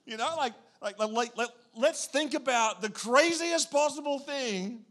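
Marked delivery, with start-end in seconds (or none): 1.8-4.9 mock dramatic. Mocking the anxious mind.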